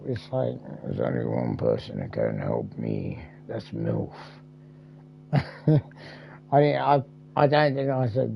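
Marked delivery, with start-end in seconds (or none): 0.8-4.1 very low, hushed put-on voice; mock-grave low voice. Answering "do you need help", low hushed voice. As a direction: sink to a deep, hushed mock confession.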